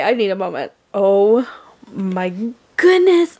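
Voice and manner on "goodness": excited squeal. Real, not acted. Overwhelmed by the new horse.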